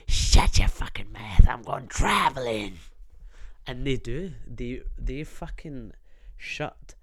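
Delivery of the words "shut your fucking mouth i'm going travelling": loud menacing whisper. Shown as a demonstration. Silencing hecklers with a fierce whisper. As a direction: hiss it close and intense. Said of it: "whisper it to them".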